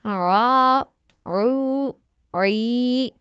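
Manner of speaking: slow, stretched drill voice, each syllable held long; letter by letter, drawn out and distinct; slow spelling recitation. Shown as a demonstration. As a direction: spell it out slowly for a child learning to read.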